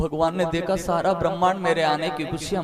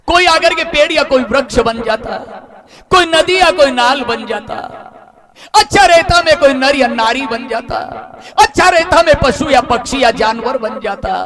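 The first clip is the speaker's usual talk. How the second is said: rising, louder pleading lament; moon's anguished complaint; 7 semitones higher, 6 dB louder than usual. Acted. Moon to Ram, grieving he missed the birth. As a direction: plead with the god, voice straining upward.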